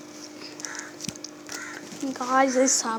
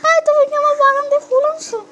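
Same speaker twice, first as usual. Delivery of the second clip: put-on much higher, louder, squeaky girl voice accusing; high girlish voice; 13 semitones higher, 7 dB louder than usual. Samira accuses Raj of taking her flowers, high squeaky girl voice. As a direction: play the little sister catching a thief.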